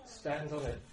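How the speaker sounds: ordinary delivery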